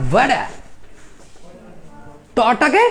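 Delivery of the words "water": "ওয়াডা" with a d in place of t; American pronunciation. Shown as a demonstration. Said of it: "আমেরিকানরা".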